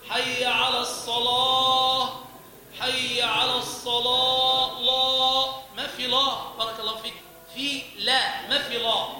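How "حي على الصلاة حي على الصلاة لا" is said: chanted slow and loud with the ل in الصلاة made thick; wrong heavy lam. Shown as a demonstration. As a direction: thicken the ل wrongly as you sing it. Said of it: "تغليظ اللام ممنوع". (تغليظ اللام الخاطئ في الصلاة)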